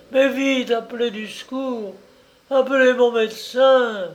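high, pained calls to her son; plead, pitched well up; mother shaking her silent son, begging for help; crying out with her last strength. Acted. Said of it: "s'écria de toute la force qui lui restait".

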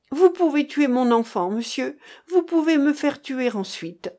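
high-pitched show of martyred resignation; resigned victim. A narrator's reading. Captive mother defying her interrogators, feigned resignation. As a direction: play the victim giving in to fate. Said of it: "avec l'apparence d'une victime résignée".

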